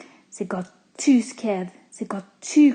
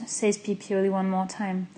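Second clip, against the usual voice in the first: ordinary delivery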